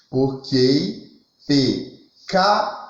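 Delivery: slow, syllables held apart; long pauses between the syllables; syllable-by-syllable scansion. Shown as a demonstration. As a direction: break the words into separate beats. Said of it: "ficaria assim".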